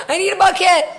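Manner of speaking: high, loud urgent yell; re-enacted frantic cry for help; retold scream. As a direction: shout it out in mock panic. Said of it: "and I scream".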